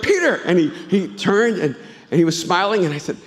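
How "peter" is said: blurted name. Retold or imitated. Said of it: "But I just went".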